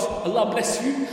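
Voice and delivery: ordinary talk, nothing marked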